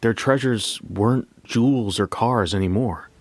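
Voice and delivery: plain reading voice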